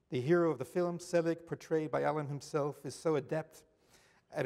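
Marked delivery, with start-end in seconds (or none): none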